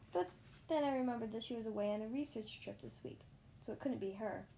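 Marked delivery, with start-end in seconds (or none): none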